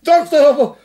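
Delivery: high, loud call. Acted. Master calling loudly for Doctor Kepler.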